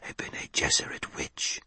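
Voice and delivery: a hushed, fearful whisper of many; hissed whisper. Acted. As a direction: hiss it under your breath. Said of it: "a multiple hissing of voices".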